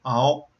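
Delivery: All with rounded lips, dark L; dark hollow l. Shown as a demonstration. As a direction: say All with dark L, tongue curved up. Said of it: "to make this sound".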